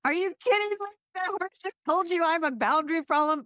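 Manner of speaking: high, fast, disbelieving outburst; can't believe what she's hearing, pitch jumping way up; incredulous. Retold or imitated.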